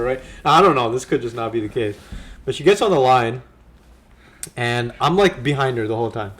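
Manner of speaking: usual speaking voice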